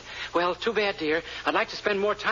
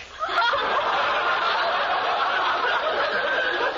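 gloating laugh. Acted. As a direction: laugh in triumph. Gloats after ordering toothbrush.

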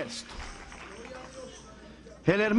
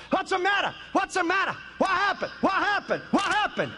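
panicked passenger; 7 semitones higher than usual. Retold or imitated. Proud passenger panics as plane drops.

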